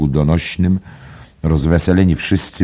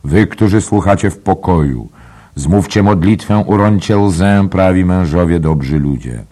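loud, raised appeal to the listeners; Dollard's praying; 4 semitones higher, 6 dB louder than usual. A narrator's reading. Dollard asks good people to pray, loud praying. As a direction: pray out loud, pitched up, easing at the end. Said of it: "modlił się bas Dolarda".

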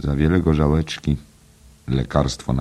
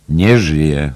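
loud exclamation, strong on NIE; exclaimed; 3 semitones higher, 8 dB louder than usual. A narrator's reading. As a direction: throw „Nie żyje” back as a loud cry. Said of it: "zawołał Martin Cunningham". (głośny okrzyk „Nie żyje!”)

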